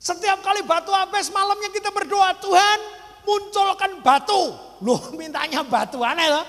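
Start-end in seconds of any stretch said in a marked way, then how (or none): 2.4-4.5 high, raised-voice plea to God, drawn out; fervent retold prayer. Family praying each night for more stones, fervent and high. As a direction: call up to God, stretching the words high.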